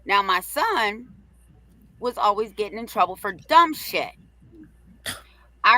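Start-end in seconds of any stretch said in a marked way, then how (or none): none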